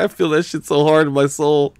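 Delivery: mock friend. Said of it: "He's like".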